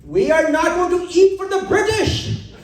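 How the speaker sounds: put-on stagey Indian-accented protest; mock Indian accent. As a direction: play it broad, thick Indian accent. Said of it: "my accent's racist".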